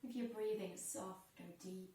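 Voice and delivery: soft, unhurried yoga teacher voice, with long pauses; guided breathing meditation. Real, not acted.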